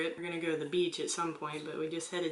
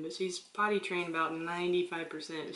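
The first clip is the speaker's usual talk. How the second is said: normal talking voice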